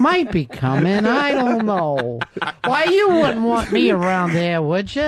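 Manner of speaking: high, goofy cartoon cat; lightly voiced timid cartoon cat. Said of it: "that cartoon cat voice".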